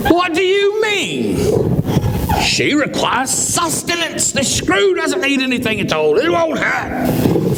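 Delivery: high, slow mock argument; bickering couple. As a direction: drag it out high like a sulky spouse. Couple argue about the sister.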